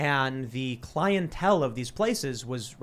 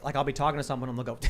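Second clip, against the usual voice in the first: normal talking voice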